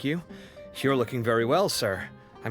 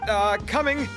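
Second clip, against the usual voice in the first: raised, higher voice answering a call; calling back; 9 semitones higher than usual. Acted. Customer answering the call for his order, calling back.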